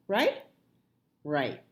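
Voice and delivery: ordinary delivery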